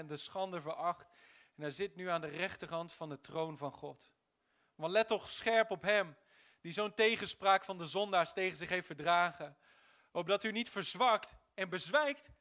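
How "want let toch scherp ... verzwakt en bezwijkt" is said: preaching. Acted. Preacher reading scripture forcefully.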